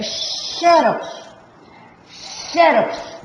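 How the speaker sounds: "xerox" with XE held long; stressed syllable demo. Shown as a demonstration. Teacher showing students where the stress falls, XE stretched in "xerox". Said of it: "Sílaba tônica tá aqui".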